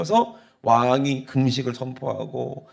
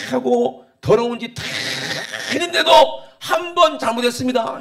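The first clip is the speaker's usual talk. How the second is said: high, loud acted grievance; indignant protest; 8 semitones higher, 5 dB louder than usual. Jonah protesting God forgiving the Ninevites, indignant. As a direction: pile up the complaints, voice pitched high.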